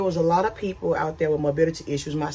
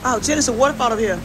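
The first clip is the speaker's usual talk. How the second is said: excited; 7 semitones higher, 6 dB louder, much faster than usual. Real, not acted. Spotting a waterfall, calling out.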